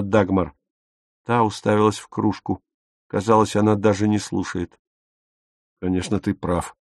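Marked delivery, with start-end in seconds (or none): 5.8-6.8 repentant. A narrator's reading. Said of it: "покаянно сказал Отто".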